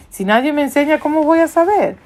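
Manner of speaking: high, rising exasperated protest; indignant retort. Retold or imitated. The Ethiopian answers Philip about having no teacher, indignant retort. Retort acted from the Bible story.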